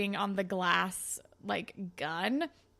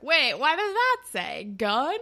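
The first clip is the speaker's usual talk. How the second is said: slow, louder puzzled realization; mock dawning alarm; 5 semitones higher, 6 dB louder than usual. Blue notices the gun warning, slow mock realization. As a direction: act out slowly catching on. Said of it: "he's like".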